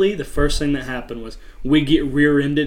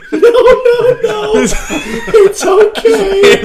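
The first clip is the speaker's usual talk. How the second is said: very high, loud mock reassurance; hammed-up calling out, cracking up; laughing shout; 11 dB louder, much slower than usual. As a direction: holler it out through laughter, drawn out. Imagines shouting out from the trunk.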